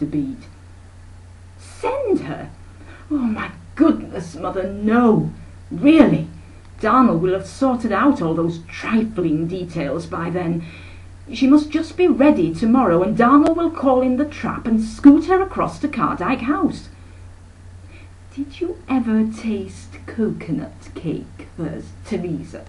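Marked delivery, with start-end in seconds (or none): 1.6-16.9 aghast at the idea, then bossy; shocked aunt. Acted. Aunt Emma waves off her sister's offer, high and shocked. 18.3-22.6 slow, airy, fading softer; a grand lady lingering over the cake; affected aunt. Acted. Aunt Emma quizzes Thirza in a slow affected voice.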